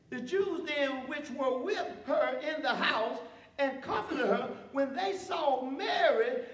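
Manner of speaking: measured, slowed Bible reading; scripture reading. Acted. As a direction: read the passage with weight. Reads scripture aloud.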